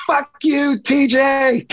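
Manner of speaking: a player shouting a friendly insult at the reporter across the clubhouse; imitated yell. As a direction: hurl it across the clubhouse as a joke. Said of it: "He yells".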